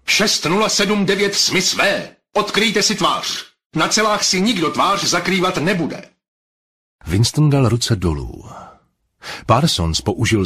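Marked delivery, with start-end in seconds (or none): 0.1-6.0 telescreen voice. A narrator's reading. Telescreen ordering the prisoner to uncover his face, commanding. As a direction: bark orders from the screen.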